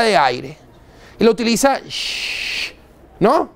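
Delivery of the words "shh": air syringe hiss. Shown as a demonstration.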